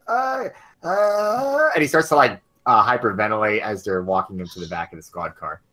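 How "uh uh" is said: high, panicked gasping; short choking gasps for air. Acted. Old man dragged to the squad car, gasping in panic. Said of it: "and he's like".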